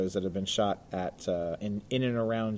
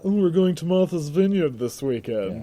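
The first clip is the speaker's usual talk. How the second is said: snobby; 5 semitones higher, 3 dB louder than usual. Retold or imitated. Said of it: "It sounds snobby".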